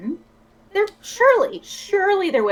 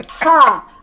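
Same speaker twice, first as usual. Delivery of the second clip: mock laugh; 5 semitones higher, 8 dB louder than usual. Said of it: "I laugh in the face of your maniacal laughter".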